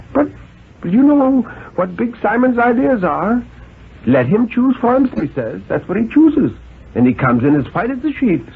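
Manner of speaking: worried mother. Acted. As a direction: fret about the boy in a high voice. Mrs Carter voiced, worried mother.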